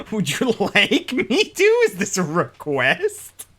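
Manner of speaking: teasing southern drawl; put-on southern accent. Shown as a demonstration. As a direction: offer it in a hammed-up southern voice. Offers the put-on voice instead.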